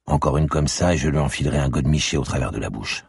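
usual speaking voice

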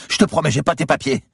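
very high, panicked cry; terrified yelling. A narrator's reading. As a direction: swear it before the blow falls. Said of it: "Il hurla les deux mains".

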